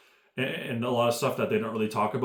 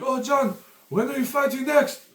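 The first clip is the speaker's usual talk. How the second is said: much higher, bright eager voice; eager interviewer; 13 semitones higher, 4 dB louder than usual. Retold or imitated. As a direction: chirp the question at John. Imitating the Russian interviewer.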